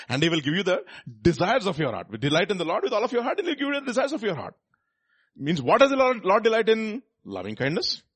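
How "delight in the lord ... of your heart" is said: fast and higher, rushing the verse. Shown as a demonstration. Preacher repeating the verse to the congregation, fast and higher. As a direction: rattle it off quickly and higher.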